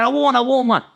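high-pitched, hurried-to-agree mock protest; protesting innocence. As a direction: imitate yourself swearing you won't. Retold protest to threatening managers.

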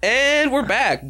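high, stretched-out show-host call; announcer's return from break. Pretend. Host bringing the podcast back from a break, announcer singsong.

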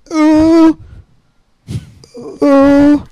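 high, loud held "ooh"; high mock hooting. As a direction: hoot a long high "ooh" in mockery. Mocking the other man.

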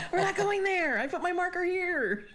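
high, laughing gamer tantrum; mock mad cussing. Player berating teammates who ignore the marker, mock mad. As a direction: scold them in comic high-pitched frustration. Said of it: "you know how mad I get".

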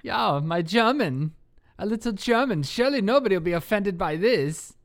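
a hammed-up German voice, only half committed; mock German accent. Cohost asks for German accent, host tries a little German. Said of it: "So perhaps you'd like to try your German accent".

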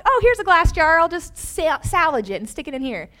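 a hint of a chirpy mom; breezy mom voice. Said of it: "So she was like".

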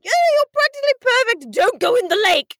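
high-pitched mock English children; bad English accent. Children warning the nanny off the lake, high bad English accent. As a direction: do kids in a hammed-up English accent. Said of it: "Anyway, bad English accents".

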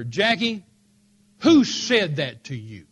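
loud, slow indignant demand; retold anger. Fiancé demanding who told Jackie that, loud indignation. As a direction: confront it hard, drawing the question out. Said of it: "it made me so mad".

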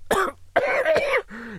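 hacking cough, high and strained; rough cough. Acted. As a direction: cough it out harshly. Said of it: "it got him coughing again".

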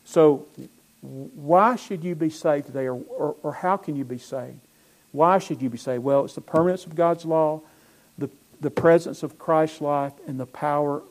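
normal talking voice

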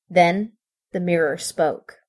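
ordinary delivery, nothing marked

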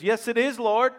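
eager retort. Retold or imitated. Mother arguing back with Jesus.

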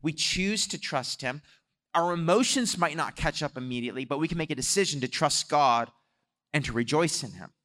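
normal talking voice

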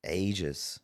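ordinary talk

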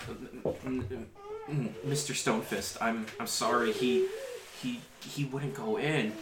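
timid, halting stammer; stammering boy. Acted. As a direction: stutter it out like a scared kid. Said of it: "and he's like".

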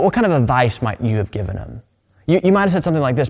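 ordinary delivery

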